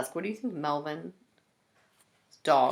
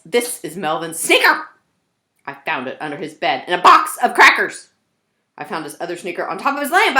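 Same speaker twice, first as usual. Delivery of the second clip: a lightly voiced fed-up mother, louder; listing the mess in disbelief; exasperated mom; 4 semitones higher, 9 dB louder than usual. Mom telling the reader about Melvin's messy room, exasperated.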